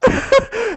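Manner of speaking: a loud, high laugh bursting out; high burst of laughter. Real, not acted. Host laughing at the end of his bank story, high laugh. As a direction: let the laugh ring out high.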